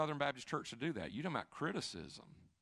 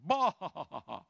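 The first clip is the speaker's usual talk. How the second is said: laughing bah; 4 semitones higher, 7 dB louder than usual. Retold or imitated. Punchline exclamation.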